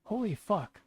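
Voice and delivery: real exasperation; exasperated holy fuck. Real, not acted. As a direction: let it burst out. Exasperated at pathetic software.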